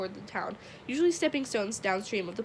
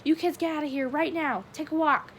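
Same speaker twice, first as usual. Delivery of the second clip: raised, forceful call from a distance; shouted order; 7 semitones higher, 5 dB louder than usual. A narrator's reading. Cop to noisy kids below, shouted order. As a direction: shoo them off from an upstairs window.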